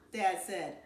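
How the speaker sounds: usual speaking voice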